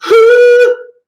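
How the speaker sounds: loud, very high sung blast; big head voice demo; belted head voice burst. Shown as a demonstration. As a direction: boom it right off the skull.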